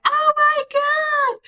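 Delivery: very high, drawn-out put-on squeal; mock gushing. Mimics expected over-sweet enthusiasm.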